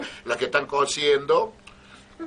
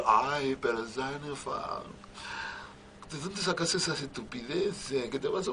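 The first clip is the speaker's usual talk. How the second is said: lady of the house, soft; 7 dB quieter than usual. Acted. Lady answering the maid.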